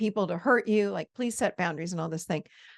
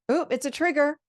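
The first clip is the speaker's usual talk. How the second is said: curious delight; 7 semitones higher, 4 dB louder than usual. Shown as a demonstration. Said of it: "I want you to reframe how you see triggers".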